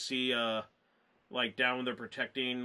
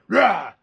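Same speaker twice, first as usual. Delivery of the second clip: sudden loud roar; mock jump-scare roar; 6 semitones higher, 13 dB louder than usual. Dog jumping out of the shadows, sudden loud mock roar. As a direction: burst out like something leaping from the dark.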